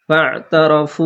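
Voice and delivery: slow ফাতারাফু with the ain from mid-throat; throat letter demo. Shown as a demonstration. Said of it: "গলার মাঝখান থেকে উচ্চারণ". (ফাতারাফু গলার মাঝখান থেকে)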